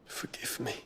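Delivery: breathy whispered farewell, barely voiced; whispered apology. To Terence before his sacrifice, a soft regretful whisper. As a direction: let it fall away to a whisper, full of regret.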